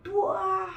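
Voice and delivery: loud, high mock blast; explosive drawn-out bang noise; imitated explosion. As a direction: boom it out suddenly. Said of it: "terdengar bunyi ledakan yang keras banget".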